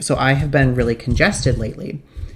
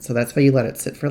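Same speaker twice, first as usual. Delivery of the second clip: normal talking voice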